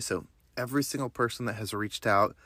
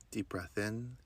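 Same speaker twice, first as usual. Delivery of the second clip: guided meditation; 2 semitones lower than usual. Acted. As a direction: guide them through breath and affirmations gently. Leads a breathing meditation.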